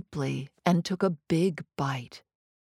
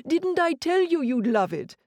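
much higher, bouncing told-you-so; excited gloating; 9 semitones higher than usual. Acted. Chef crowing to his friend, excited told-you-so. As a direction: crow that you were right.

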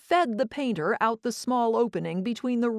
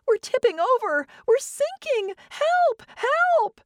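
high, loud frightened shrieking; panicked shriek; 5 dB louder than usual. Acted. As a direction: shriek for help as the boat tips. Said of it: "Anne immediately began to shriek".

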